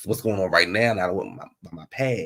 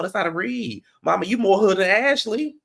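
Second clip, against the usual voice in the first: ordinary delivery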